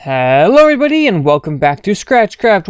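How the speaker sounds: bright, loud host greeting with a big jump up on everybody; YouTube intro. Acted. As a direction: welcome viewers like opening a show.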